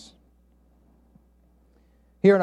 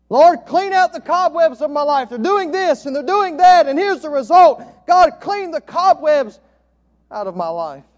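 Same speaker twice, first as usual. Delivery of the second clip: pleading prayer; 7 semitones higher, 9 dB louder than usual. Retold or imitated. Man begging God in prayer.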